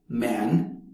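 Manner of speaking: man with a flat American vowel. Shown as a demonstration. Teacher showing American man, American accent. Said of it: "in American".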